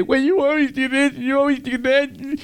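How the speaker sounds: high, whiny grumbling at God; mock complaining. Preacher mimics a grumbling believer blaming God, whiny complaining. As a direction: whine like an ungrateful complainer. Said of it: "Don't come to God and say".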